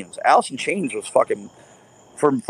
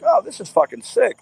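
excited discovery; 9 semitones higher than usual. Retold or imitated. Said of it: "and I'm like".

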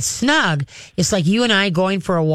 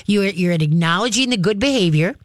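ordinary delivery

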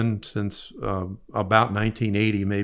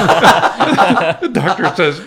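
laughing, higher, louder and slow; laughing through the punchline of his story; 10 semitones higher, 12 dB louder, much slower than usual. Real, not acted. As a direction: laugh your way through the words. Laughing while telling the punchline.

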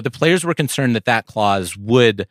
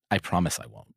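quiet mock promise; 4 semitones lower, 10 dB quieter, faster than usual. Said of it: "So he said".

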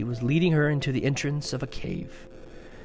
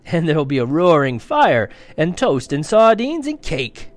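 rising louder and higher with each treat; tempting fawn; 3 semitones higher, 8 dB louder than usual. A narrator's reading. The fawn lures Lucy with tea treats, building louder. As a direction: tempt her with a feast.

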